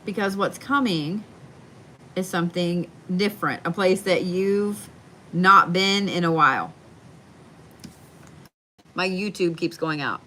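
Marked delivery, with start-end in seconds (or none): none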